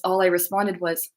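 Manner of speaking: ordinary delivery, nothing marked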